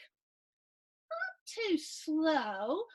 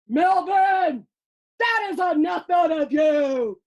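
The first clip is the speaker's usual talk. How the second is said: loud bus driver scolding; angry shout; 3 semitones higher, 12 dB louder than usual. Acted. Fed-up bus driver silencing Melvin, shouting. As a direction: shout the boy down.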